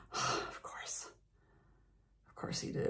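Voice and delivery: whispered, weary of course he did; resigned whisper. Retold or imitated. Locked out by him, whispering in resignation. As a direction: sigh it out under your breath.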